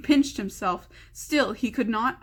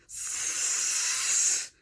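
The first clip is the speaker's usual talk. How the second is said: long drawn unvoiced hiss; long creature hiss; 4 dB quieter than usual. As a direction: hiss like a snake. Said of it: "hissed Gollum".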